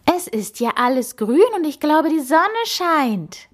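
high-pitched, delighted exclamation; thrilled, high voice; enthusiastic. A narrator's reading. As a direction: call it out with soaring excitement. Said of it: "rief sie begeistert".

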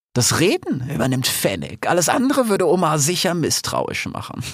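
high-voiced scheming lioness plans the attack; a cunning lioness savouring her plan, pitched high. Aisha plotting the revolt with her pack, high and scheming.

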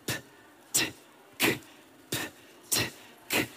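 whispered voiceless P, T, K in a row. Shown as a demonstration. Choir warm-up coach, whispered P T K. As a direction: breathe out P T K with no voice. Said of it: "P, T und K geflüstert".

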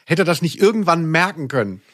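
usual speaking voice

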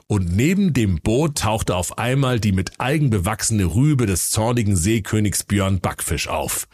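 usual speaking voice

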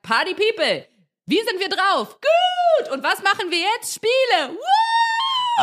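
high, excited party announcer voice; party host hype. Acted. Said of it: "Und wenn man sagt".

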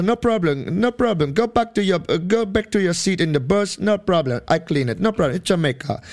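laid-back Jamaican "no problem, man"; Jamaican hotel porter. Retold or imitated. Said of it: "Und er meinte".

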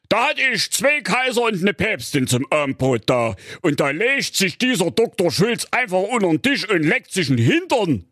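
put-on a puzzled old king raising a complaint. A narrator's reading. King complains about the dog.

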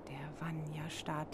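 whispered narration; hushed suspense. A narrator's reading. Narrator hushes listeners as the bear appears, whispering. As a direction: breathe it out in a whisper.

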